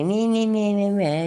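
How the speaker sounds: high mocking blah voice; mocking meh meh. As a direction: mimic them with high meh meh. Mimicking auditors reading scripts.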